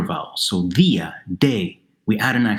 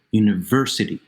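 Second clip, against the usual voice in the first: "university" with VER stressed; stress on third-from-last syllable. Shown as a demonstration. Teacher shows students where English stresses it, stress on VER in "university". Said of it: "It's in the third from last syllable".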